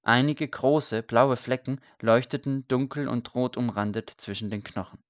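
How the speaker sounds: ordinary delivery, nothing marked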